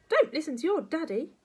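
high, loud granny command; bossy grandma. Acted. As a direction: forbid it as a cross old woman. Grandma ordering George.

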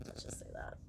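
breathy whispered aside. Real, not acted. Trucker sighing about stress, whispered aside. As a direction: drop to a whisper, let it go.

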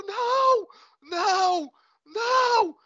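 high, loud repeated no; anguished crying out. Acted. Man breaking down at his brother's ghost, high anguished cries. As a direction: wail the denial in panic. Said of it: "Ele começa a falar mais alto".

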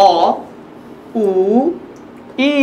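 "অ, উ, ই" as separate long vowels; long vowels demo. Shown as a demonstration. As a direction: draw out "অ, উ, ই", pausing between each. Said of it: "ঠিক এইভাবে".

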